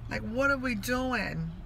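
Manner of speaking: soft, disappointed lament; hushed, heartbroken plea. Retold or imitated. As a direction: say it to yourself, with long pauses. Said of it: "it just broke my heart".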